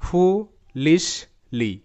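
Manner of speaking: ফুলিশলি stretched out slowly, syllable by syllable; teacher breaking ফুলিশলি into slow parts; slow syllable breakdown. Shown as a demonstration. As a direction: draw out ফুলিশলি part by part. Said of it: "ভেঙে নিয়ে করি তাহলে হবে".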